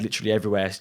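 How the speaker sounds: normal talking voice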